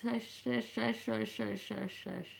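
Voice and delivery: repeated soft "shush", feeling out the sh sound; quiet, repetitive "shush" as a mouth exercise; mouth-testing shushes. Shown as a demonstration. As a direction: try "shush" over and over, quietly. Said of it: "Saying that was really weird on my mouth".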